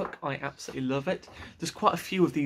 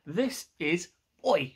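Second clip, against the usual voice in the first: plain reading voice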